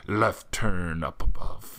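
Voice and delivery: slow, low put-on character voice; practicing character voice. Shown as a demonstration. Player rehearsing a character voice on road signs, slow and low. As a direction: draw the words out low, trying on a character. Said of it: "so I start looking at road signs".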